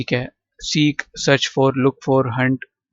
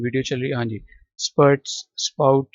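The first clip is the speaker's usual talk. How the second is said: ordinary talk, nothing marked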